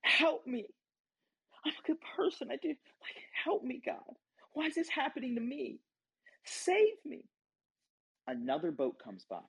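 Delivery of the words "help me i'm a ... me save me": high, strained cries begging God for rescue; frantic surfer begging God to save him, high and strained; desperate pleading. Retold or imitated. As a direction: plead for your life, voice climbing high. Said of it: "Back to the surfer".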